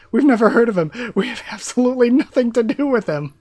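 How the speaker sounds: high, loud frantic denial; panicked denial. Acted. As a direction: blurt it out, terrified of blame. Guards denying to Lady Witch.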